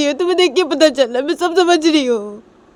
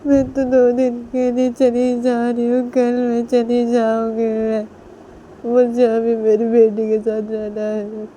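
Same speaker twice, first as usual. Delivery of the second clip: higher, slow, drawn-out wail; tearful refusal; 5 semitones higher than usual. Acted. Nisha refuses to hand over her baby, wailing tearfully. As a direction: cry through a slow, stubborn refusal.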